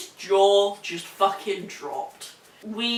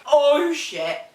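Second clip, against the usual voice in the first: louder, startled mock gasp of shock; shocked "oh shit"; 5 semitones higher, 5 dB louder than usual. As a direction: react as the shocked receptionist. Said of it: "it's like".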